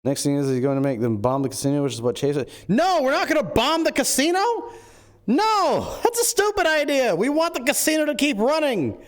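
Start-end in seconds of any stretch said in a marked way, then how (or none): 2.7-7.2 exasperated objection. Acted. High exasperated protest against Chase's plan to bomb the casino. 7.2-9.0 "We want the casino" pitched much higher, pushed; exasperated insistence. Acted. High insistent reply that the casino must keep running. Rejecting Chase's bombing plan.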